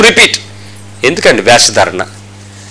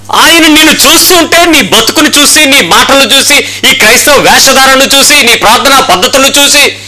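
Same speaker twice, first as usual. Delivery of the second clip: raised, driving pulpit rebuke; forceful preaching; 6 semitones higher than usual. Acted. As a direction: hammer the accusation home to the church.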